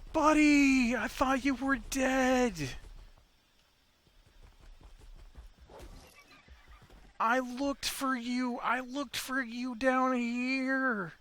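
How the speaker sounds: high, slow, gushing to the pet; overjoyed reunion. Real, not acted. Player relieved to find his pet alive, high gushing. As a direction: call out to a lost buddy with delight.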